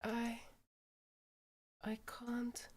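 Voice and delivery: quiet and breathless; breathing heavily. Acted. Yuri to Ryuki as she starts to panic, breathy. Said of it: "Yuri starts to breathe heavily".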